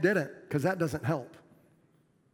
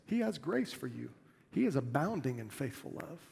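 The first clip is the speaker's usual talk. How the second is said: the same words said again much softer; softer, as a reassurance to the congregation; soft repeat; 2 semitones lower, 8 dB quieter than usual. Shown as a demonstration. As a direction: drop the voice and say it gently.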